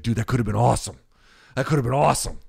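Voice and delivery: imitating an over-keen friend; mock enthusiasm. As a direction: gush with hammed-up regret at the missed chance. Retold friend's enthusiastic regret.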